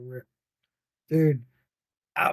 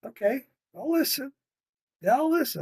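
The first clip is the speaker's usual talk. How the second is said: meek mock voice; 8 semitones higher than usual. Mocking viewer's change of heart.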